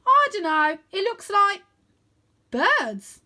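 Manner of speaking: high, loud uncertain reply; shouted answer. A narrator's reading. Wyn shouting back to Arthur about the cloud, unsure. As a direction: call back over the wind.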